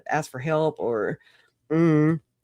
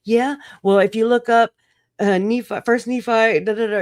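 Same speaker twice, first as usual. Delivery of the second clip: higher, louder smug church-lady voice; mocking church ladies; 7 semitones higher, 5 dB louder than usual. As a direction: parrot the smug scripture-quoting ladies. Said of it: "Melanie Gibb just being like".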